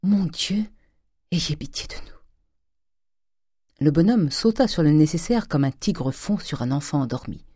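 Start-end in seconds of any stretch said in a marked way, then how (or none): 0.0-2.1 high gasp falling to a whisper; breathy plea, from alarm to hush; understated frightened cry. A narrator's reading. Madame Grandet seeing her husband's look, understated frightened cry. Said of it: "madame Grandet cria".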